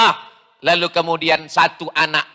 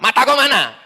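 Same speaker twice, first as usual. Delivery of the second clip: a mock gruff snap, laughing; blunt Sumatran retort; 6 semitones higher than usual. Preacher imitating a Sumatran whose foot was stepped on, mock gruff with a laugh. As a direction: bark it like a brash Sumatran. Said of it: "orang ngomongnya keras".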